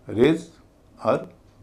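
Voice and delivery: रेजर्वेशन very slowly, broken into separate syllables; slow teacher's demonstration of रेजर्वेशन, each syllable apart. Shown as a demonstration. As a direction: break रेजर्वेशन into syllables with clear gaps. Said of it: "उसका उच्चारण भारी हो जाएगा".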